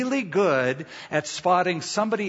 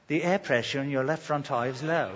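put-on a light British accent, softer, like a polite car voice; 5 dB quieter than usual. Pastor imitating a car's British voice alert, lightly. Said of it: "it might even be in a British accent".

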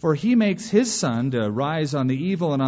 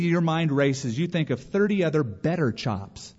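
ordinary talk, nothing marked

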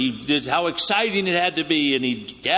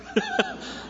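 burst of laughter; 6 dB quieter than usual. Real, not acted. Breaks up laughing.

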